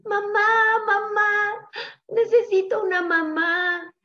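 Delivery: high, wailing chick in mock tears; acted high-pitched crying plea. A narrator's reading. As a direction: wail for mother like a little lost chick. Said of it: "empezó a llorar".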